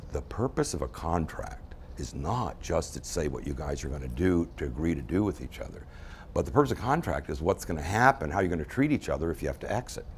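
low, soft, patient lawyer; lightly voiced calm lawyer. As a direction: explain it calmly as the lawyer. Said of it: "very calmly".

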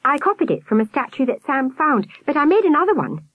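put-on high boyish boast; proud boy. A narrator's reading. Albert telling about his model, proud child. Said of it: "he added proudly".

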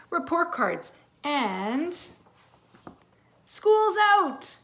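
slow build, then a loud, high whoop; excited announcement. Acted. Mister Ratburn announcing school's out, slow then bursting high. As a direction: draw it out, then burst out the last words.

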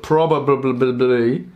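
"probably" slowed and garbled into repeated b sounds; tongue-tied. Real, not acted. Tripping over English mid-review, "probably" babbled slowly. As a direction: stumble on "probably", babbling the middle syllables. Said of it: "my English messing up".

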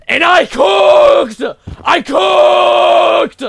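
high, drawn-out triumphant yell; boastful shout. Real, not acted. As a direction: shout the brag, stretching the last word. Bragging about game wins.

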